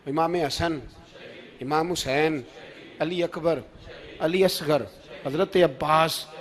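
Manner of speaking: ordinary talk, nothing marked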